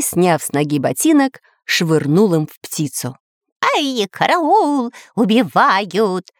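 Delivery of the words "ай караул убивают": drawn-out, high cry for help; high, stretched-out mock alarm. A narrator's reading. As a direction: wail it out slowly like a panicked parrot. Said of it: "закричал Кутя-Плютя".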